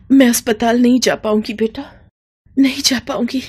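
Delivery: barely able to speak; faint mother in pain; weak dying mother. Acted.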